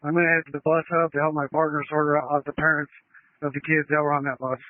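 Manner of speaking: tinny radio transmission; like a voice on a two-way radio; over a radio. Real, not acted. Field unit calling on radio.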